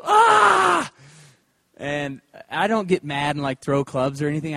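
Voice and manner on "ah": sudden high yelp of frustration; high, short cry of annoyance at himself; frustrated yell. Retold or imitated. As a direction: yelp at a bad golf shot. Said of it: "I just go".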